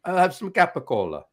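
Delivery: Italian-born customer, every syllable sounded; correct Italian pronunciation. Acted. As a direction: order with careful Italian vowels. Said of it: "saying it correctly and everything else".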